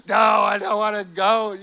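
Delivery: high, loud tearful yell; child shouting. Retold or imitated. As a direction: yell it like an upset little boy. Son shouts back through tears.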